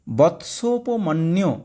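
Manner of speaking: বৎসোপমন্যু said slowly, syllable by syllable; slow broken reading. Shown as a demonstration. Teacher slowly showing students বৎসোপমন্যু. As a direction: split বৎসোপমন্যু into slow parts. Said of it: "ভেঙে দিয়েছি মন্যু".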